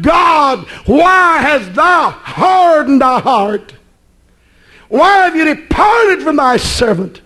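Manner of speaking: drawn-out preacher's cry to God; retold crying out. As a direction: call out like people pleading with God. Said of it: "They cried out".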